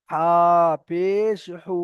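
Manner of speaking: no lengthening on the last হু; short final vowel. Shown as a demonstration. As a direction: stretch the names হা পেশ, then a brief হু. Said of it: "এখানে টান হবে না".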